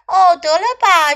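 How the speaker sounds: loud, high-pitched coaxing; urging sister. A narrator's reading. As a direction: brush off your brother's reluctance loudly. Sister urges reluctant brother along. (大声劝哥哥别抱怨)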